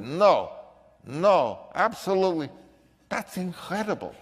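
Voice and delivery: amazed Viennese boss, imitated; Viennese accent. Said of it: "he had a Viennese accent".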